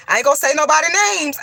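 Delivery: fast, high, rushed protest; rapid high-pitched worked-up aside; heated aside. Real, not acted. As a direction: blurt it out quickly, worked up.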